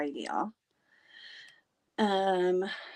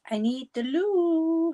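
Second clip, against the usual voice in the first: whiny plea; 11 semitones higher, 7 dB louder, much slower than usual. Real, not acted. Whining to Ange about the loo.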